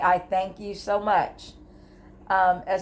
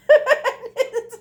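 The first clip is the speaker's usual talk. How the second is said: sudden loud laugh, much higher; high burst of laughter; 6 dB louder than usual. Real, not acted. Remembering the popular bed video, bursting into laughter.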